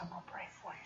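soft unvoiced whisper; a hushed, secret whisper. Whispered to girl at register.

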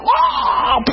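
very high-pitched mock shriek; imitated scream. Preacher imitating a sudden manifestation, mock scream. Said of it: "all of a sudden he's going".

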